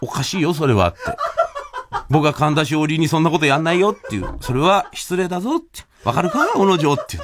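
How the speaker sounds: a hint of a scary boss; stern scolding. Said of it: "十七倍ぐらい怖い感じで".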